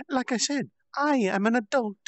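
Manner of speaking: put-on high childish voice, stressing adult; insisting she's an adult. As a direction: insist like a child playing grown-up. Milim repeats her claim.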